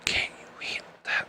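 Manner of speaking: hushed, breathless whisper of a psycho line; Ben Stiller imitation. Quoting a movie line to podcast listeners, whispered menace. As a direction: whisper it into a hidden camera like a psychopath. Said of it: "it's Ben Stiller that says it".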